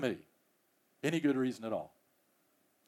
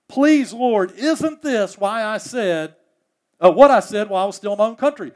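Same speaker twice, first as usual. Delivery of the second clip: louder, higher, exasperated complaint; a mild mock rant of Jonah; griping Jonah; 5 semitones higher, 7 dB louder than usual. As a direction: whine at God like an aggrieved prophet. Preacher re-voices Jonah's gripe.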